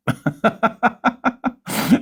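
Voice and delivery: high, gleeful cackle of triumph; high gloating laugh. Real, not acted. Gloating over rivals on his stream, a high gleeful laugh.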